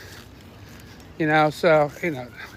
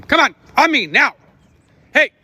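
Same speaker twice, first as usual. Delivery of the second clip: loud, high firm command; calling the dogs; 9 semitones higher, 6 dB louder than usual. Real, not acted. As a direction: demand the dogs come now. Calling dogs back to heel.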